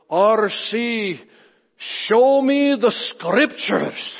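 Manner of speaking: put-on slow, high lilting Irish or Welsh accent, show me the scriptures drawn out; Scottish-ish Alistair Begg accent. Pastor imitating Alistair Begg's Irish or Welsh accent at RC. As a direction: mimic a lilting Irish or Welsh preacher demanding proof. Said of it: "his wonderful, I don't know, is it Irish or Welsh voice".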